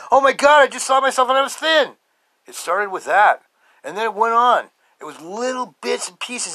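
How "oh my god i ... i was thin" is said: loud, high surprised joy; amazed delight. Retold or imitated. Man seeing himself thin for the first time, loud and amazed. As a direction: gasp it out as if you just saw it.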